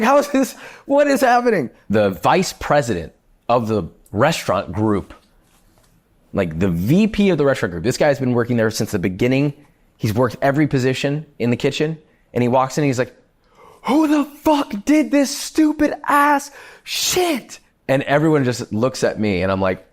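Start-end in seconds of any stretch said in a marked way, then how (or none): 0.0-1.7 high-pitched retold bewilderment; panicked disbelief. Acting out panic as flames erupt. 13.8-17.6 high, slow, drawn-out mock fury; furious boss impression. Vice president's angry outburst retold.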